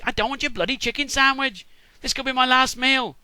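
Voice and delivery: high-pitched mock indignation; acted exasperated complaint; indignant. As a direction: protest loudly like a fussy, put-out old dad. Acted father rejects offered chicken sandwich.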